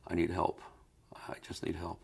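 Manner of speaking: soft, trailing into near-whisper; retold quiet plea for help, fading; pleading. Gray-faced man asks the trainer for help, quietly pleading.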